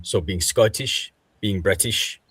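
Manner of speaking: ordinary talk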